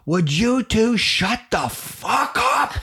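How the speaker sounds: high, slow, drawn-out roar of exasperation; exasperated dad. Retold or imitated. Dad snapping at two fighting kids, exasperated. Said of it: "he looks up and he says".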